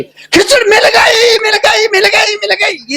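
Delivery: very high, drawn-out exultant cry; hammed-up shout of discovery; elated cry. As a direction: wail it out in acted triumph, slowly. Fool joyfully finds the forgotten word.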